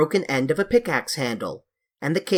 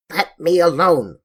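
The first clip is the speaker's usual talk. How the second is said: louder, strange husky creature voice; dry husky voice; 3 semitones higher, 6 dB louder than usual. A narrator's reading. Hidden sand creature warning the children off, dry and husky. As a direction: growl it up from under the sand. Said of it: "a dry, husky voice".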